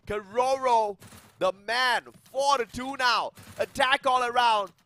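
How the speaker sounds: loud, high celebratory shouting; hype shout. Real, not acted. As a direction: roar it like a champion call. Co-caster celebrates the clutch.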